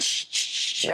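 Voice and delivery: unvoiced shushing scrub sound; imitated washing noise. Washing things in the tiny sink, imitated swishing. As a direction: make swishing noises for soapy water.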